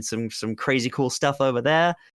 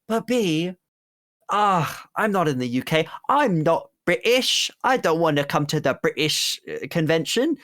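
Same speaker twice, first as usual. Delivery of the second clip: lightly put-on British accent, raised pitch; mock British accent; 5 semitones higher than usual. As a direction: tease them in a hammed-up British accent. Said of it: "a really fucked up thing of you to say in that accent".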